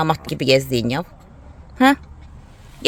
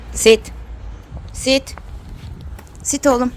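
dog commands; 6 semitones higher, slower than usual. Real, not acted. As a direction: order the dog to sit.

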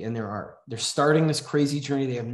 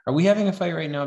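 joking jab; 6 semitones higher, 5 dB louder, faster than usual. Retold or imitated. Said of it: "she jokes".

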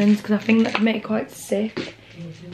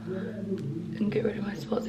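whisper; 11 dB quieter, slower than usual. Real, not acted. Whispering while family nearby.

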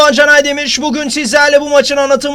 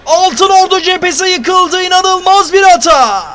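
screaming football commentator; 4 semitones higher, 8 dB louder than usual. Real, not acted. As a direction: roar the goal call at full voice. Goal scored, commentator erupts.

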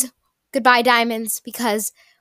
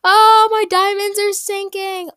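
mock dismay; 9 semitones higher, 3 dB louder than usual. Real, not acted. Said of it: "I'm so nervous right now".

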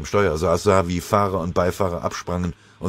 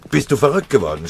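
loud, high furious rebuke; shouting; 8 semitones higher, 7 dB louder than usual. Acted. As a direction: yell at him to hold his fire. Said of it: "schrie er". (wütend geschrien)

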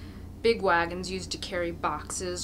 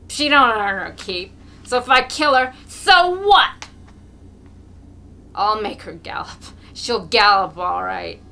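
put-on drunken boast; 13 dB louder than usual. A narrator's reading. Drunk Mokolka boasts loudly.

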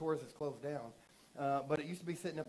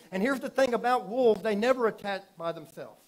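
full-force preaching; 3 semitones higher, 9 dB louder than usual. Acted. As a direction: hammer the warning home to the church.

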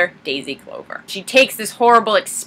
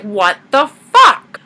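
slow, louder retold outburst; higher, drawn-out fed-up disbelief; mock exasperation; 4 semitones higher, 5 dB louder, much slower than usual. Daisy interrupted by the doorbell, mock exasperated.

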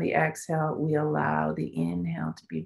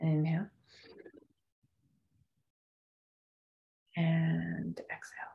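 guided meditation; 11 dB quieter than usual. Acted. Guides breath holds softly.

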